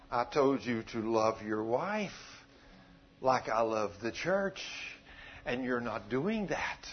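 low, soft and slow, measured phrases; a hushed inner voice, slowly correcting; God's gentle reproof. Acted. God's thought comes to the praying husband, quiet reproof.

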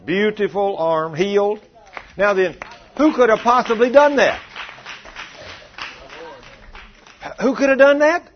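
full-throated revival preaching; preaching at full force. Acted. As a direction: build it up to a big praise shout. Preacher building to a shout.

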